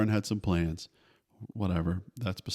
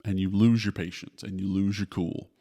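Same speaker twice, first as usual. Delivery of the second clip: normal talking voice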